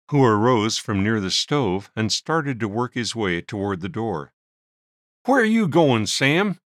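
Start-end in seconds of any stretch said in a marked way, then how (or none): 5.2-6.6 sharp demanding question, higher and louder; a miner's blunt demand. A narrator's reading. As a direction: call him back with authority. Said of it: "Shunk Wilson demanded".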